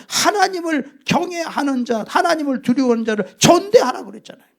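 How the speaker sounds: loud, insistent sermon delivery; preacher exhorting the church to honor God-fearers; fiery preaching. Acted. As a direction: hammer each point home to the congregation. Preaching at full force.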